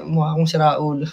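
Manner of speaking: normal talking voice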